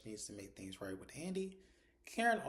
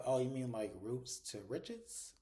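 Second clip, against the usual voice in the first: drawn-out quip; slower than usual. Retold or imitated. Woman answers a remark about being rich, drawn-out quip.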